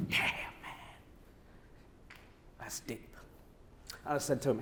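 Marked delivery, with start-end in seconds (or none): 0.1-3.1 hushed whisper, barely voiced. AJ answering the sad phone call, hushed whisper. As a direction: breathe it out, taken aback.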